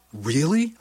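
high, rising disbelief; astonished question. A narrator's reading. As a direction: check it in amazement. Astonished at the confession.

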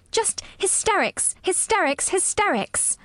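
"hysterics" high and emphatic, falling each time; stamped repetition. A narrator's reading. Girl stamping at the boy, "hysterics" hammered high each time. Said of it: "she stamped each time she said it".

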